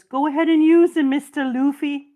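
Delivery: high, loud excited offer; eager anime character. Retold or imitated. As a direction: hype it up like a devoted fan. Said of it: "dude has to turn around and says".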